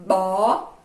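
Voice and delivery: "Bo" held long as a syllable alone; teacher demonstrating the single syllable "Bo"; isolated syllable demo. Shown as a demonstration. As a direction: sound out "Bo" as one separate, drawn-out syllable. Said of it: "Quantas vezes você abre a boca?".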